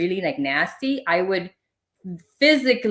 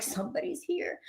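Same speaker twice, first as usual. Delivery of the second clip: much softer and higher, almost breathed; hushed warning; 10 semitones higher, 12 dB quieter than usual. Retold or imitated. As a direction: lean in and tell him someone's here. Retold hushed warning to husband.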